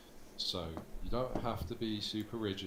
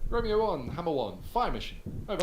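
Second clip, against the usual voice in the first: radio call response; 7 semitones higher, 7 dB louder than usual. Shown as a demonstration. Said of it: "and I'll play Hammer one".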